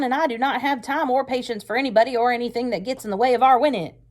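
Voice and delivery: loud, high, hard-driving officer's rant; angry commander. Acted. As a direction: bark it at the recruits, furious. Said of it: "He looked really mad".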